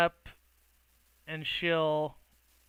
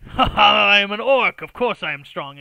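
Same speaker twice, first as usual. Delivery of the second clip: loud, higher laughing boast; boasting orc; 5 semitones higher, 14 dB louder than usual. Acted. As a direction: bellow it proudly.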